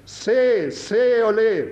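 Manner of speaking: high preacher's call; Methodist preacher calling. Retold or imitated. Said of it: "ropte han bare som en metodist kunne rope".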